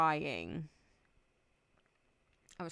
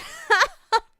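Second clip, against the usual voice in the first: high, loud burst of laughter; sudden real cackling laugh; 15 dB louder than usual. Real, not acted. Laughs at own lonely line.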